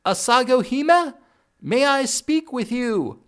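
loud, high call over a clattering loom; shouting to be heard. A narrator's reading. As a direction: call out loud across the noise. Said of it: "Otter was forced to shout to be heard".

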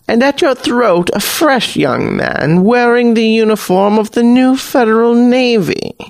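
high knowing hag voice, unhurried; an old sea witch reading the pirate; Hag Queen. Acted.

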